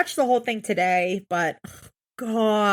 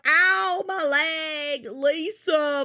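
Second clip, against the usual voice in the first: higher, hammed-up whimpering; mock pained whine; 3 semitones higher, much slower than usual. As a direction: fake cries of pain for the drama. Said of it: "There's no".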